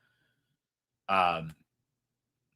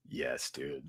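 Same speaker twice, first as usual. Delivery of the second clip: softly whispered "Yes, dude. Dude," with no voice; 13 semitones lower, 15 dB quieter than usual. Real, not acted. Streamer watching a gory kill clip, awed whisper. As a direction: breathe it out in awe.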